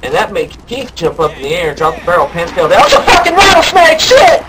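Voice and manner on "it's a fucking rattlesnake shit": high, loud, panicked yelling. Retold or imitated. Keith yelling in panic at a rattlesnake, panicked high yell. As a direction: scream it like you just saw a snake.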